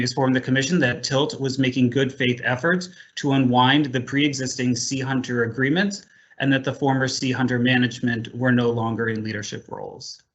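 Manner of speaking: ordinary talk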